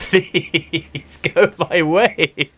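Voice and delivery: slow, laughing, high plea. Real, not acted. Gamer begging the game to go his way, laughing. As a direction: beg the game through laughter.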